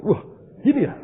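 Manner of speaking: normal talking voice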